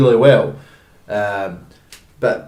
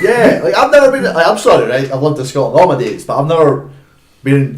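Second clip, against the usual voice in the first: incredulous laughing outburst; 4 semitones higher, 9 dB louder than usual. Real, not acted. Laughing disbelief about sunny Scotland.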